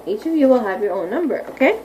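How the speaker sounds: usual speaking voice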